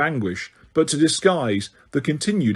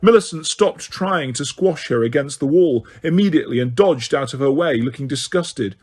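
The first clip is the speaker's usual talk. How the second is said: ordinary delivery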